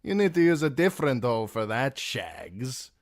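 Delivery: lightly voiced Dornish drawl; a hint of Dornish drawl, amused. A narrator's reading. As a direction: Timion teasing Shagwell in a Dornish drawl. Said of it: "in his Dornish drawl".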